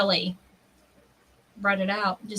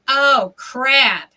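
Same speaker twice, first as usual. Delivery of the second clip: loud, higher, alarmed exclamation; retold dismay; 4 semitones higher, 7 dB louder, much slower than usual. Retelling seeing the sheriff step out, dismayed. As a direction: let the mock dread burst out.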